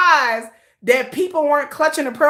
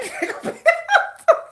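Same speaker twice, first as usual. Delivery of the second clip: high laugh; 13 semitones higher than usual. Real, not acted. Laughing at her own joke.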